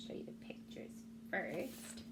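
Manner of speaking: soft unvoiced whisper to the class; hushed teacher aside. Real, not acted. Teacher aside while showing book.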